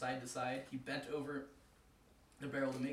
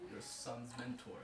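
slow, softer rasp; 4 semitones lower, 7 dB quieter, slower than usual. Acted. Death sneering at Anansi, slow rasp. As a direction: sneer it out quietly. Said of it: "the old man rasped".

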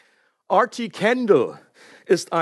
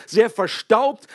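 usual speaking voice

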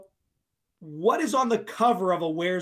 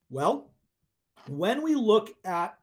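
ordinary talk

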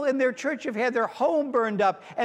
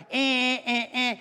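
mock horn honks; 4 semitones higher, slower than usual. Horn honks in traffic story.

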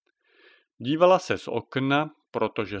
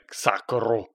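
cursing again; 2 semitones lower than usual. Acted. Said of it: "vykřikl podruhé".

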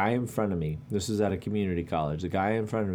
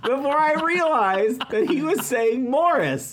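high laughing; 7 dB louder than usual. Real, not acted. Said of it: "That's awesome".

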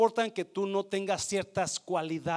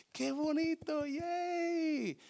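high gushing praise; 9 semitones higher, 5 dB quieter, much slower than usual. Retold or imitated. Coworker praising accent.

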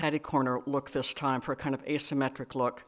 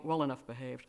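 plain reading voice

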